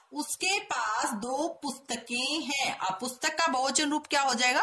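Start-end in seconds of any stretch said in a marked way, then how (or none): 0.1-2.8 slow careful reading. Shown as a demonstration. Teacher reading the correct sentence slowly to students. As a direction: read the corrected sentence gently, word by word. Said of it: "सही वाक्य बनेगा".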